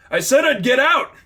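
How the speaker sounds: very high, loud desperate yell; panicked shout. Acted. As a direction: scream to be let go. Figment panicking under attack.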